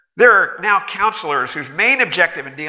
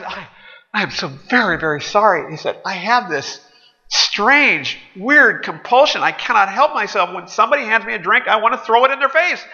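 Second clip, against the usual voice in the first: higher, apologetic pleading voice; sheepish customer; 6 semitones higher than usual. Acted. Customer excusing himself to the waiter, meek apologetic. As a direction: apologize sheepishly, a bit pitched up.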